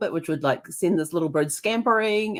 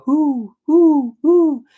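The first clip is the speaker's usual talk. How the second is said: high, loud hooting owl; 8 semitones higher, 8 dB louder, much slower than usual. Retold or imitated. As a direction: hoot like an owl. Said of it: "the owl would go".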